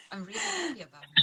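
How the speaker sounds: quick, laughing words. Real, not acted. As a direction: let a real laugh carry the line. Guest laughs while answering.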